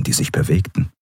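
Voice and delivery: ordinary delivery, nothing marked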